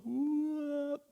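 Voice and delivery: a sudden high whoop as the boat lurches; whooping boat sound. Retold or imitated. Telling the congregation how the boat lurched, high whoop. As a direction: squeal it out like a boat jolting under weight.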